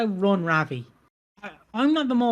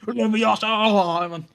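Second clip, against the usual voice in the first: very drawn-out, higher incantation; mock spell-casting; 4 semitones higher, much slower than usual. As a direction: stretch the magic word out playfully.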